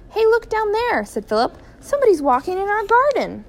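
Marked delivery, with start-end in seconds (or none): 0.1-1.0 put-on high, loud boy calling out; piping, urgent shout; excited call. A narrator's reading. Boy calls out from sky. 1.8-3.4 put-on high, loud, amazed boy; piping child's exclamation; excited call. A narrator's reading. Boy calls out from sky.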